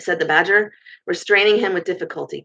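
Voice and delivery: ordinary delivery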